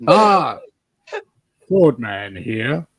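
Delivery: slow, drawn-out hammed-up American accent greeting. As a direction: drawl it out like a proud good old boy in an American accent. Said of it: "he's putting on his great American accent".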